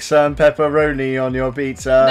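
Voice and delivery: imitated robot monotone. Mocking the pizza robot's small talk, monotone voice. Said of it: "epic small talk in a monotone voice".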